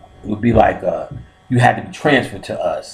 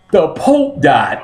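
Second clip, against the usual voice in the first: dramatic; 6 semitones higher, slower than usual. Retold or imitated. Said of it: "'cause he did it so dramatic".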